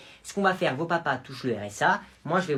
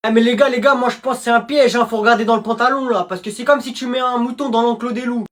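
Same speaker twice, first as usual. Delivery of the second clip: higher, loud excited yelling; shouted warning; 7 semitones higher, 7 dB louder than usual. Acted. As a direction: warn the gang at full volume. Kid warns the class.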